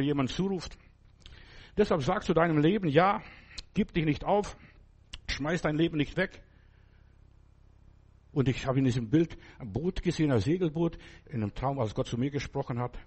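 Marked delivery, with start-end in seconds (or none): none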